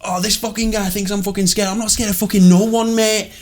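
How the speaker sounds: high-pitched, put-on mouthy bravado; mock someone boasting they fear nobody; dickish tough talker. Woman bragging in front of a security guard, mocked bravado. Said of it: "You sound like a dick".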